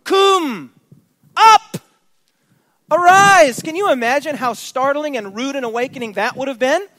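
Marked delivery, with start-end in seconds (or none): 0.0-0.7 Kum shouted high and loud; Kum as a sudden loud command. Shown as a demonstration. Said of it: "The very same Hebrew word". 1.4-3.6 high, loud, sudden commands; a captain roaring up and arise; startling shout. Acted.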